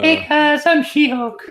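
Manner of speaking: put-on high falsetto girly voice; girl voice. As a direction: squeal it like a girl. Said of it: "talking with the girl voice".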